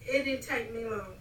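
normal talking voice

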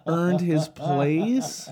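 slow, high disbelieving repeat; incredulous. Real, not acted. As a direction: drag it out in disbelief.

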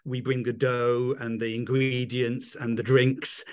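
normal talking voice

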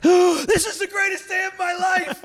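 high-pitched gasping thrill; acted exhilaration. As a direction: squeal it mid-drop on a rollercoaster. Acting out thrill of the drop.

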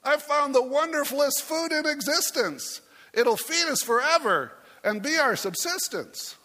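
high, sing-song shout of pure delight; excited shouting. Acted. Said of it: "he ran into camp shouting".

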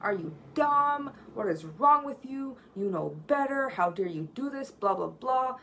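mock-scold the kid as if losing it; imitating an angry yell. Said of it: "wanted to yell and be like".

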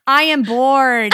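loud, slow mock whine; bored kid. Mom imitating her son at the funeral, mild loud whine. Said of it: "out loud in a talking, talking tone said".

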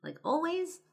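high, astonished question; surprised disbelief. Retold or imitated. Friend in the interview, surprised she always knew, high disbelief. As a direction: imitate a friend caught off guard. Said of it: "he's kinda surprised".

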